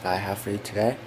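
talking around a retainer; slightly lisped retainer speech; wearing a retainer. Real, not acted. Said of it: "Sorry, I'm wearing my retainer".

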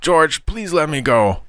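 high-pitched frantic begging; desperate, squealing promise; earnest pleading. A narrator's reading. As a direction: plead to be released. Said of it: "this earnest request".

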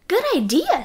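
a pleased quick yes; 1 mother voice. A narrator's reading. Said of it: "said his mother".

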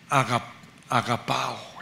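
agapao said slowly as a careful Greek term; teacher spelling out the Greek "agapao"; Greek word agapao. Shown as a demonstration. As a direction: sound out "agapao" syllable by syllable. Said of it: "aquí enfatizo la palabra en el griego".

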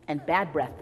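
plain reading voice

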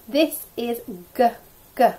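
ordinary delivery

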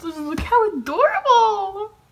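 high, loud, stretched-out cooing squeal; gushing squeal. Real, not acted. Showing viewers the hung-up duck drawing, gushing squeal. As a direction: draw it out, squealing at the cuteness.

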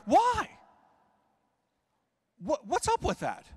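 high indignant why; exasperated high why. Acted. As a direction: demand it in disbelief. Indignant rhetorical question.

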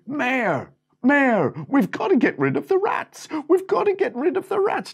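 high, loud crowd plea; calling out. Acted. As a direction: shout up at the town hall.

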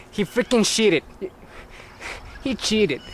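usual speaking voice

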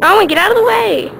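loud, high urgent yell; shouting. Real, not acted. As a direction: yell him out of the way. Yelling at brother.

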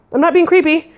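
high-pitched, hurried denial; hasty. A narrator's reading. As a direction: blurt it out defensively. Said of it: "she added hastily".

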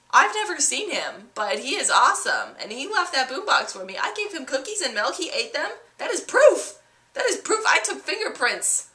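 put-on high, loud kid voice, huffy and proud; indignant little girl. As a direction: insist like a stubborn child defending Santa. Girl insists Santa exists.

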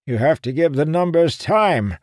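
put-on high, loud testy old man; irritated snapping. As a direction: scold him sharply. Annoyed seer scolds.

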